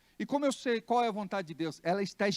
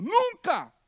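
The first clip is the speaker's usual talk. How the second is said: ordinary delivery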